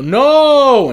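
high loud no; hammed-up no; mock Luke scream. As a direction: yell it like Luke in the premiere. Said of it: "And I go".

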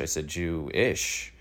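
slow, spelled-out excuse; mock Santos, spelling it out slowly. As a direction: drag it out like a lame excuse. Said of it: "he's like".

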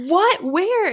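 high, loud startled questions; jolted awake, demand answers in a panic; acted-out startled yell; alarmed. Acting out waking in alarm.

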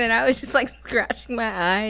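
ordinary talk, nothing marked

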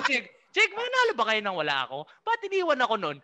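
hammed-up outrage at the rookie; retold angry rant. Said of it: "Galit na galit ako".

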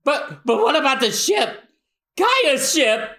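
high, anxious character voice; worried protest. Acted. Crewman asking after the stolen ship, worried.

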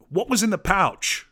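loud, high-pitched baffled demand; exasperated outburst. Real, not acted. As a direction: burst out, fed up with not knowing. Exasperated at an unexplained detail.

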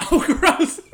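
loud, high laughing oh gross; loud laughing disgust. Real, not acted. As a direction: cackle out your disgust.

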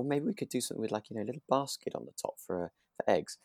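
ordinary talk, nothing marked

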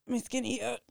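talking while holding breath; strained, held-breath voice. Real, not acted. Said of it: "I'm holding my breath".

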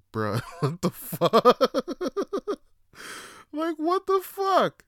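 high, loud, drawn-out bafflement; laughing disbelief. Acted. Passenger left sitting there baffled, incredulous and laughing. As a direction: stretch it out high, breaking into laughter. Acting out his baffled reaction.

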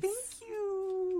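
very high, drawn-out thank-you; retold thanks squeaked up high; cutesy thanks. Retelling a reply to Brooklyn's compliment, high and cutesy.

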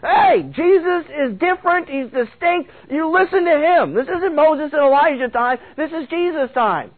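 high, insistent divine rebuke; God's voice. Acted. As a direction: lay down the law from on high. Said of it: "God's voice, saying".

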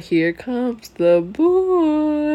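slow, put-on voice. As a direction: stretch it out in a put-on voice. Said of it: "you sound, you like".

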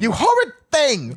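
a squealed, indignant insult; shrill mock outrage. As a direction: fling it out like an offended girl. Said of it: "I can picture her saying that".

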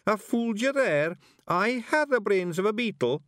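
high, loud, proud pixie boast; triumphant little simpleton; Daft Willie. A narrator's reading. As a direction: boast foolishly, pitched right up. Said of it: "sticking out his chin with pride".

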